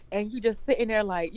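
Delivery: ordinary talk, nothing marked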